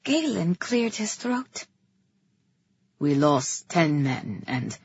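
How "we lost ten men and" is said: low, slow report; subdued officer brother. A narrator's reading. Brother reporting losses to the king, low and subdued.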